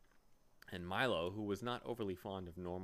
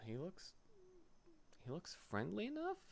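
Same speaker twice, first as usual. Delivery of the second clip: soft, hesitant inner voice; thinking aloud; 11 semitones higher, 8 dB quieter than usual. Acted. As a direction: murmur it doubtfully. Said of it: "thought Milo".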